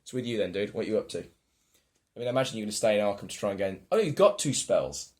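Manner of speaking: ordinary delivery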